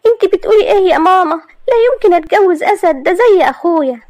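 put-on high, loud young woman's protest; girl crying out against the marriage; indignant young woman. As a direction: refuse her mother in shock. Maria refuses to marry Asad.

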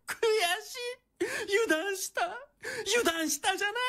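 crying in frustrated defeat; tearful defeat. Acted. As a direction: choke out the words through tears. Defeated villain breaks into tears.

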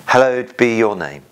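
ordinary talk, nothing marked

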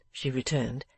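usual speaking voice

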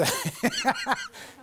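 high burst of laughter; laughing. Real, not acted. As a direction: break up laughing mid-story. Laughs while retelling Hank's story.

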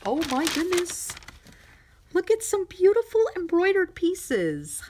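very high, delighted exclamation; excited. Real, not acted. Showing viewers the embroidered pieces, excited. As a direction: gush over a lovely surprise gift. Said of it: "I got a little excited there".

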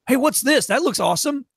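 high, loud-starting mock enthusiasm; upbeat put-on admiration; impressed curiosity. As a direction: imitate a friendly, intrigued passer-by. Imitating impressed station employees.

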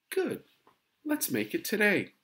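very high, loud cheerful urging; pleased mother. A narrator's reading. Mother urges daughter back to school today, cheerful. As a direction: jump in brightly to coax a child.

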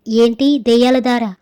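usual speaking voice

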